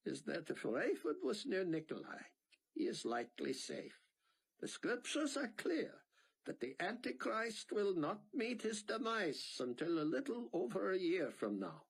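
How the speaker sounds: a hint of a thick Israeli accent. A narrator's reading. Sion reassuring Buck, Israeli accent. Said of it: "in his thick Israeli accent".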